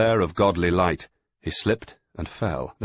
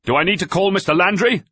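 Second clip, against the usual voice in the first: loud, fast, high threat; stern shouted threat; 9 dB louder, faster than usual. A narrator's reading. Doctor threatening the shoving sailors, stern shout. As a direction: threaten them sharply down the stair.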